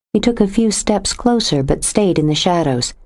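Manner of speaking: plain reading voice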